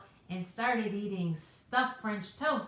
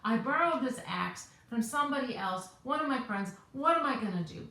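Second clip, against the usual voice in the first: retold panic, louder and higher; loud, high, flustered quote; freaking out; 3 semitones higher, 5 dB louder than usual. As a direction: act out a mild freak-out over a mishap. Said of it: "freaking out".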